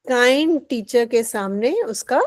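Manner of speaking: ordinary talk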